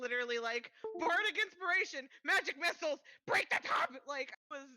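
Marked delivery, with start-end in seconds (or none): 1.0-3.9 excited shout. Retold or imitated. Players shouting at the table.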